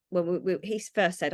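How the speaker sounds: usual speaking voice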